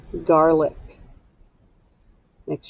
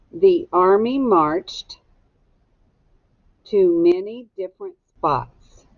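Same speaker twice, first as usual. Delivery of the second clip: slow, clear dictation pace; slower than usual. Shown as a demonstration. Teacher dictating a sentence slowly for students to echo. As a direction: say each word slowly so students can write it. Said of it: "echo me".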